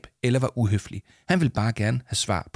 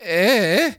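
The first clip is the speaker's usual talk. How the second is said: hesitant stammer; 10 semitones higher, 5 dB louder than usual. A narrator's reading. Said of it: "stammede Leo Manchot".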